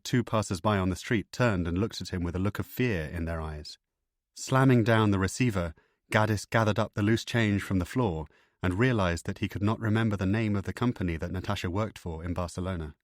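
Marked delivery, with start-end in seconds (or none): none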